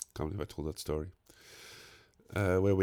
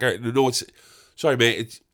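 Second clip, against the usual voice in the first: usual speaking voice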